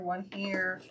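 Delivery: ordinary talk, nothing marked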